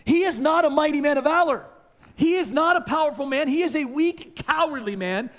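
high, loud insistence; preacher pressing the point loudly; emphatic preaching. Real, not acted. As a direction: hammer the point home.

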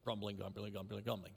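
"grumbling" muttered low and soft, over and over; mock grumbling. Shown as a demonstration. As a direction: grumble the word "grumbling" under your breath like a complaint. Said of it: "What does it sound like?".

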